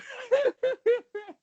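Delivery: squealing burst of laughter; high-pitched laugh. Real, not acted. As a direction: shriek with laughter. Laughing at GM joke.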